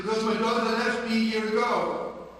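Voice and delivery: high, loud cry of grief; anguished cry. Acted. Father telling the drunkard of his lost daughter, loud anguish. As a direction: cry out the loss.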